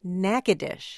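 Natchitoches echoed as NACK-uh-dish; correct local pronunciation. Shown as a demonstration. Host echoing the tour guide, correct pronunciation.

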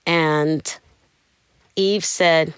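usual speaking voice

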